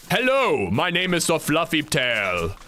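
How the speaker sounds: hammed-up epic hello, slowing into the name; boisterous epic rabbit. Shown as a demonstration. Rabbit character introducing himself, boisterous and epic. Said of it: "but I talked like this".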